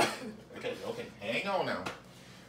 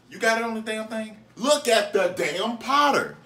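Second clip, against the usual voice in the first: exasperated yell; 7 semitones higher, 9 dB louder than usual. Real, not acted. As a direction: drag it out, fed up. Scolding helper over powder amount.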